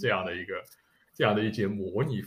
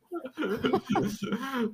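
high laugh; 3 dB quieter than usual. Real, not acted. Laughing over a breakdown story.

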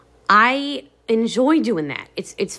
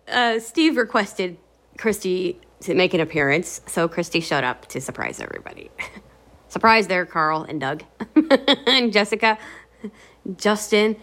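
ordinary delivery

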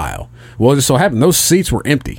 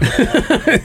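high quick giggle; short amused laugh; 12 semitones higher than usual. Real, not acted. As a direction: burst into a brief chuckle. Laughs at the agreement.

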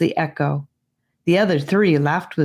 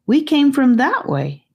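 lightly voiced girl; 10 semitones higher, 3 dB louder than usual. Voices Annika.

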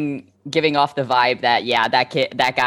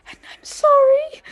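tearful. Acted. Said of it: "Rowan's like".

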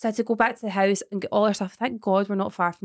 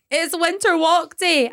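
teacher voice; 9 semitones higher, 5 dB louder, slower than usual. Retold or imitated. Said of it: "she was standing at the door going".

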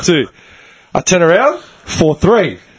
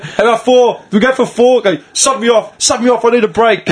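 excited; 6 semitones higher than usual. Retold or imitated. Excited teasing teammates.

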